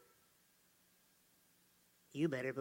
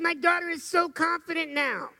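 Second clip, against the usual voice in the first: excited mother; 8 semitones higher, 5 dB louder than usual. Retold or imitated. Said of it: "She said".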